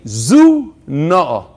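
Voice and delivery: Zu Na'a, said as two separate chopped words; chopped Zu Na'a demo; split syllables. Shown as a demonstration. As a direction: break the word apart deliberately. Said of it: "you chop it and you say".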